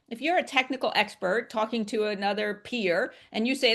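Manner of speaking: normal talking voice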